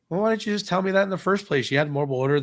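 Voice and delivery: high-pitched complaint; exasperated retort. Retold or imitated. Customer complains to clerk about late explanation, exasperated. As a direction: challenge them, exasperated.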